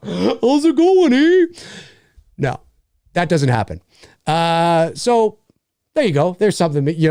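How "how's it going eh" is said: high, drawn-out cartoon Canadian with laughter; mock Canadian accent. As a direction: stretch it out in a silly Canadian accent. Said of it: "it's not like the Canadian accent".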